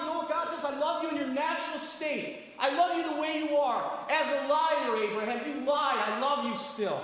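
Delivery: high-pitched, driving sermon delivery; fervent preacher pressing the point home; impassioned preaching. Acted. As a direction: start loud, then keep preaching high and insistent. Preaches at full force.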